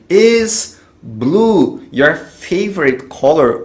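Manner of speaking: pergunta em inglês lenta e articulada; bem devagar, didático. Shown as a demonstration. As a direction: dite devagar como professor. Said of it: "vou colocar ele na frente do sujeito". (pergunta lenta para o aluno repetir)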